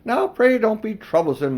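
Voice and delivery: high, loud coaxing; hammed-up fussy Uncle Andrew; pleading old man. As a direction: wheedle impatiently. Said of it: "said Uncle Andrew".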